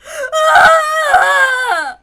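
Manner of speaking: shrill piercing shriek; panicked scream. Acted. Ava shrieking in fever panic, a terrified scream. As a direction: scream in terror. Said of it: "Ava panicked scream".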